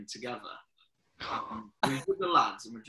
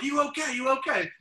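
worried taxi driver; 11 semitones higher, 5 dB louder, faster than usual. Retold or imitated. Taxi driver rushing to crash.

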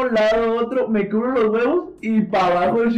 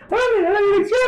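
principal's voice; 13 semitones higher than usual. Retold or imitated. Quoting the principal.

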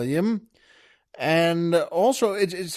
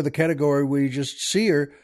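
ordinary talk

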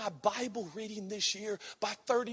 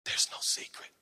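breathy whisper, no voice; 5 dB quieter than usual. Acted. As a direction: whisper it like a secret. Whispered line in sermon.

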